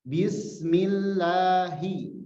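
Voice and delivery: "Bismillahi" very slowly, each sound held; slow recited. Shown as a demonstration. Teacher leading students in slow recitation. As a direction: recite "Bismillahi" for the class to repeat. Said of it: "kita latih bismillahnya".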